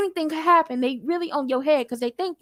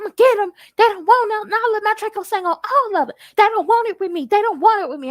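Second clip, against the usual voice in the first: high, loud, furious threats; raging rant; 8 semitones higher, 5 dB louder than usual. Retold or imitated. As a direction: act out a man losing it, yelling. Retelling the executioner's raging rant.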